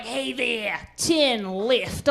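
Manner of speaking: sexy Australian male GPS voice; hammed-up seductive Australian navigator; Australian accent. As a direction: slide into a flirty Australian man's drawl. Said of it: "Australian mail G P S man".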